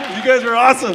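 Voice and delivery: loud, high shout to the audience; real crowd thanks. Real, not acted. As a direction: call out thanks over the cheering.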